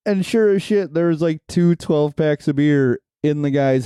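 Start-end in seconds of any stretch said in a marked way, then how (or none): none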